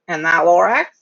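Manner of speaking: plain reading voice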